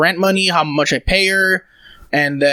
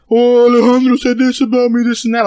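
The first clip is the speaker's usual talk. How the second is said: high, louder, whiny mock of a crying son; imitating a mother's higher mocking whine; 7 semitones higher, 4 dB louder than usual. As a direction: mimic her mocking him. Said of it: "she started mocking how".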